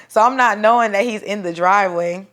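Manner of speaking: usual speaking voice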